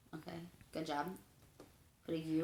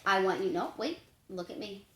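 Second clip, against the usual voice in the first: ordinary talk, nothing marked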